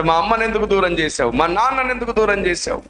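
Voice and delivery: put-on a hurt boy sobbing out his questions to God; anguished, high and rising complaint; crying out to God. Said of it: "మోకరించి ఏడుస్తున్నాడు".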